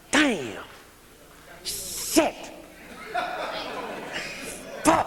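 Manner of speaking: mock groggy cursing; waking up cussing. Addict waking up in active addiction, weary cussing. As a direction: mutter curses on waking. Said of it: "We wake up cussing like".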